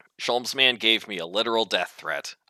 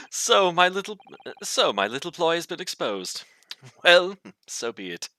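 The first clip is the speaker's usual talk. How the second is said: theatrical villain confession; exposed schemer; 9 semitones higher, 3 dB louder than usual. Acted. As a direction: own up grandly to the ploy. Voices Sholmes's exposed villainous ploy.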